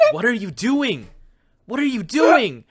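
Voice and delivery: high, loud outraged shriek; shrieking outrage. Acted. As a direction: scream at them in shock. Gwendola confronts them, voiced.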